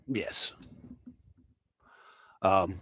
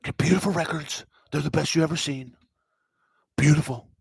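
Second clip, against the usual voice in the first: dramatic record pitchman; 4 semitones higher than usual. Retold or imitated. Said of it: "the guy's so dramatic".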